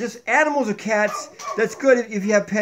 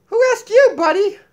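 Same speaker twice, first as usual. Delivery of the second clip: high, lively puppet voice; Donkey puppet voice; 12 semitones higher, 7 dB louder than usual. Acted. Puppet Donkey lecturing viewers about cats, high puppet voice. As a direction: play the sassy donkey puppet, pitched up.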